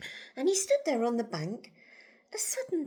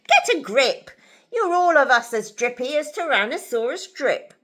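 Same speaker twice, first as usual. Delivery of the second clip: loud, higher storybook rebuke; scolding dad; 4 semitones higher, 12 dB louder than usual. A narrator's reading. Narrator voices Dad to his trembling family, scolding. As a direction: tell them off forcefully.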